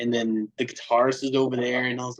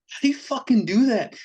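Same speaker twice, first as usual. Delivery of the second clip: amazed; 12 semitones higher, 3 dB louder than usual. Retold or imitated. Fan asks the vocalist.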